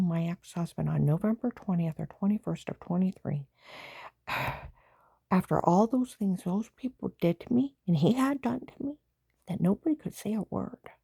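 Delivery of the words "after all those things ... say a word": heated rant. Real, not acted.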